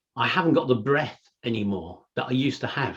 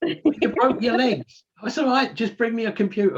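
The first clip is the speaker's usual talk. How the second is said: mock dialogue; 9 semitones higher than usual. Imagined hospital scene with broken legs, high mock plea. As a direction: play the stubborn podcaster begging from hospital. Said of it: "I'd be going".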